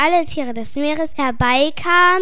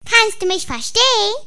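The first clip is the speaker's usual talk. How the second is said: high squeaky little toy voice; 7 semitones higher than usual. Acted. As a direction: squeak it out like a tiny rubber figure. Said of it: "mit piepsender Stimme".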